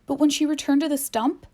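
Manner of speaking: ordinary delivery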